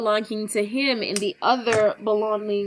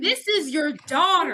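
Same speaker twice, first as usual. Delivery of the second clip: mock indignation; 6 semitones higher than usual. Dean realizes he was conned.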